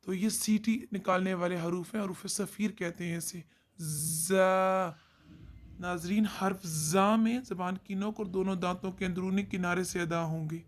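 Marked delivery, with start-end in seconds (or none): 3.8-5.0 जा with a hissing buzz like a whistle; whistling za. Shown as a demonstration. Teacher demonstrating a whistling letter, hissed buzz. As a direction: buzz जा through the teeth. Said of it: "सीटी की तरह आवाज़ निकालते हैं".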